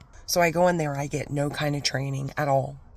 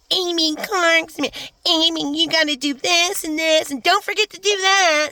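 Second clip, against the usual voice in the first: bossy vet imitation; 14 semitones higher, 6 dB louder than usual. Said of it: "he's like".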